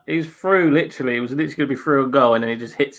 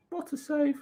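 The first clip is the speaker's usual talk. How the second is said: hushed awe; 11 dB quieter than usual. Real, not acted. Awed reaction to a save.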